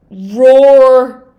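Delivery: a loud roared word; mock roar. Shown as a demonstration. Coach acting out a child's frustration, loud mock roar. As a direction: belt it out like a frustrated kid. Showing how a child vents.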